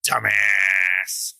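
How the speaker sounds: drawn-out, emphatic catchphrase put-down; Red Foreman. Retold or imitated. Quoting a TV dad's catchphrase, in a drawn-out scolding voice. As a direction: stretch the insult out slowly and hard. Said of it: "Specifically, he would say".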